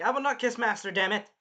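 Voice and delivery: loud, high protest; imitated annoyed outburst; indignant retort. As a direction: act out a heated denial. Acted quarrel, indignant reply.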